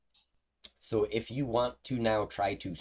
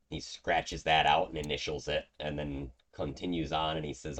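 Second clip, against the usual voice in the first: ordinary delivery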